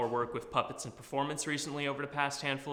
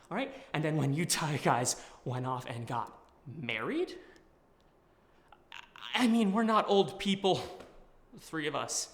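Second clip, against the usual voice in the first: put-on pleading with an old friend; earnest, urgent confession; dramatic monologue; 5 semitones higher, 5 dB louder than usual. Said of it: "doing a monologue".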